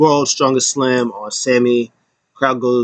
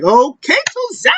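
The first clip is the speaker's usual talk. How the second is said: high, rising mock disbelief; mock incredulity; 14 semitones higher than usual. Viewer reacting to Tozawa stopping Chad Gable, mock incredulous. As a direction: voice leaping up in exaggerated surprise. Acts startled reaction to the intervention.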